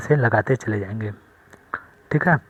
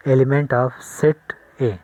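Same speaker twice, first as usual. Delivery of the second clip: each word drawn out slowly for the class; slow dictation; much slower than usual. Shown as a demonstration. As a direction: spell it out slowly for students to copy. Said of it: "ऐसे लिखते हैं".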